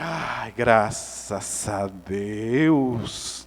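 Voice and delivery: very slow, low sigh of relief; hammed-up exhausted thanks; acted relief. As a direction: draw out thanks as you collapse in relief. Retold relief after rescue.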